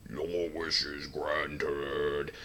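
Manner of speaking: slow, booming low dragon god; drawn out like a giant dragon; deep slow Shenron voice. Retold or imitated.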